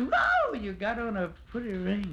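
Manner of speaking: high, loud squealing whoa; a woman marvelling at the suitor's coat; woman's whoa. Retold or imitated. Woman admires his ring.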